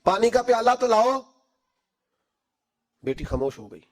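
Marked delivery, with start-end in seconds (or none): 0.0-1.2 raised, higher call to a daughter in the next room. Acted. Father calling his daughter for a bowl of water, raised voice. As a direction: call out to her across the house. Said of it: "آواز دی".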